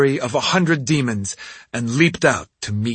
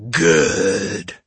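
rumbling demon; 4 semitones lower than usual. Acted. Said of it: "it rumbled".

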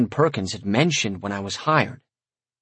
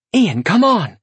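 urgent yell, pitched up and loud; 9 semitones higher, 5 dB louder, slower than usual. A narrator's reading. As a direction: shout for him to follow. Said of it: "Ilaria yelled".

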